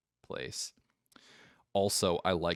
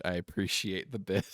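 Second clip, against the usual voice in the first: usual speaking voice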